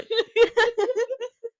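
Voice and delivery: high burst of laughter. Real, not acted. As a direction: let out a high giggle. Laughing at toilet paper joke.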